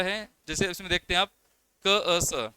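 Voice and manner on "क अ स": क, अ, स as separate sounds. Shown as a demonstration. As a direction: isolate each sound in क, अ, स. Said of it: "इसमें जो अ की साउंड आई थी".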